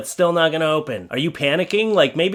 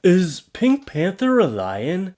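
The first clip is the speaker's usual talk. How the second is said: slow, higher-pitched naive question; slow puzzled asker; 4 semitones higher, slower than usual. Acted. One asking a silly question about a cartoon, puzzled. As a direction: ask it slowly, like you truly don't know. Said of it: "but slower".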